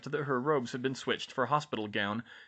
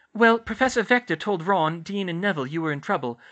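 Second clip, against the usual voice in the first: put-on high, louder girl's voice; Ginny's character voice; 8 semitones higher, 7 dB louder than usual. Ginny explaining events to Hermione, high character voice. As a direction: recount it in a raised, lively voice.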